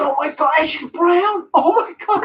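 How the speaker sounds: high, shrieking mock awe; mock gasping fan. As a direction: shriek it like a fan thrilled by nothing. Mock gasp at brownness.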